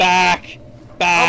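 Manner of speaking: shouting. Real, not acted. Zombie rushing, warning friends.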